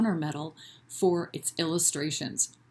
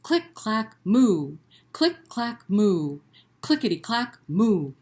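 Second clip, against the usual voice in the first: rhythmic chant, moo punched loud; call-and-repeat refrain; 4 semitones higher, 6 dB louder than usual. Shown as a demonstration. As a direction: lead the kids through it. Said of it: "can you say that with me".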